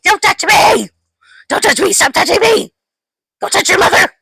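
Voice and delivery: put-on high, shrill childish tantrum voice; mock a screaming toddler; whiny little child. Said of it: "a little child".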